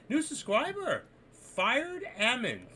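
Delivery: stretched-out welcome call for a new subscriber; excited announcement. Real, not acted. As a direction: herald the new subscriber like a big arrival.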